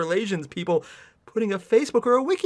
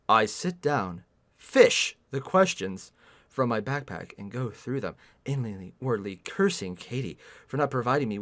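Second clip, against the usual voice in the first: story narration; 8 semitones lower, 6 dB quieter than usual. Acted. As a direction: read the passage out dryly. Reads the fanfic aloud.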